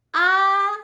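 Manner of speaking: "ah" held high and loud; high first tone. Shown as a demonstration. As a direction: sustain "ah" up high. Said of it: "first tone is a high tone".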